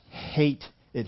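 "hate" punched and stretched out; stress on hate. Retold or imitated. Preacher repeating the runner's complaint, with emphasis on "hate". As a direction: hit "hate" hard, then drop off. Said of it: "emphasis on hate".

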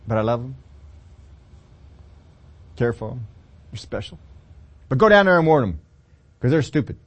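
soften, then blurt the insult; tender God voice. Acted. God turns tender, then blunt.

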